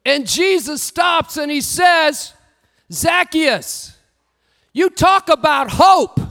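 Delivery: shouted sermon climax; preaching at full force. Acted. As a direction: lift the crowd with it.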